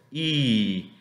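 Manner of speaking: drawn-out "I", like ee; held ee sound on "I"; long vowel I. Shown as a demonstration. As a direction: stretch "I" in a smile. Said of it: "que son alargadas o largas".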